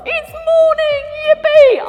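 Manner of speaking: high, loud joyful whoop; bursting out of bed; loud yippee cheer; excited shout. Acted. Waking excited.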